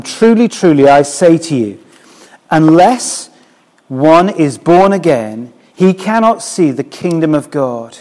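preacher reading scripture with weight. Acted. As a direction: declaim Jesus' words slowly, loud at the start. Reads Jesus' words aloud.

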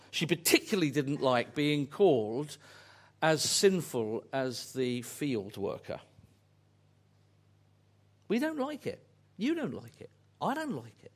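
ordinary delivery

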